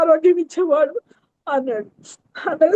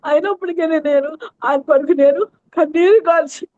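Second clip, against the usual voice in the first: ordinary delivery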